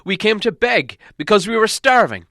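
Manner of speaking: high, loud pleading dwarf; a starving prisoner begging the king, high and loud; desperate Thorin. A narrator's reading. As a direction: plead your innocence, voice pushed high.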